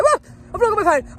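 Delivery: high, loud startled exclamation; re-enacted alarm. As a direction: act out sudden panic. Re-enacts discovering phone is missing.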